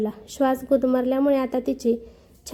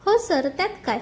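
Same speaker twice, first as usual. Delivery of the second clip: ordinary delivery, nothing marked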